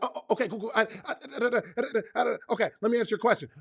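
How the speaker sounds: rapid-fire stammered gibberish, half laughing; talking fast. Acted. Busy boss juggling several questioners at once, talking fast. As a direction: rattle through syllables in a playful rush. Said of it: "I'm gonna talk fast to them".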